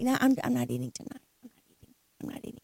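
trails off into a secret whisper; hiding it, voice dropping away to nothing; fading to a whisper. Retold or imitated. Wife hides her fast from husband.

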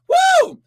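a high, loud preacher's whoop; sudden high woo of worship; ecstatic woo. Real, not acted. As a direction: let out a joyful shout of praise. Ecstatic cry mid-sermon.

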